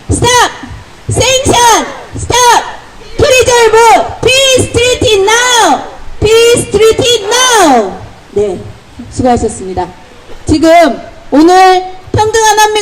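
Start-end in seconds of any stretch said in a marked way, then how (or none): 0.1-7.9 high, drawn-out rally shouts in sequence; 구호 연달아 외치기. Real, not acted. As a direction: chant the slogans one after another, call and response. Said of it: "한번 해보도록 하겠습니다". (높은 목소리로 연이어 구호 제창)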